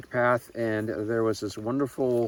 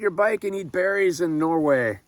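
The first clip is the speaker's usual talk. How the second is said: excited; 9 semitones higher, 6 dB louder than usual. Real, not acted. Excited about free berries.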